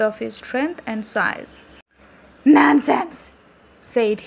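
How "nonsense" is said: loud, scornful monster voice; annoyed ghoul. Acted. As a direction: dismiss it like a proud ghoul.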